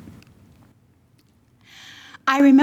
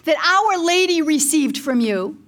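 retold screaming; 7 semitones higher than usual. Mother demanding Our Lady's blessing from the Lord, retold screaming plea. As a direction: demand it of God in a high, impassioned voice. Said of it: "I am screaming this in the bedroom".

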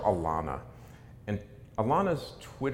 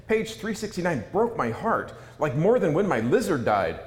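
mock outraged teen; 6 semitones higher, 5 dB louder than usual. Reading a teen's furious fan message.

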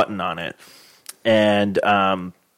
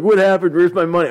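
dazed; 7 semitones higher, 3 dB louder than usual. Pretend. Waking up after knockout.

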